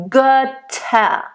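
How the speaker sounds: Götter stretched slowly, open ö. Shown as a demonstration. Teacher models the vowel slowly. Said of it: "nice ö, open".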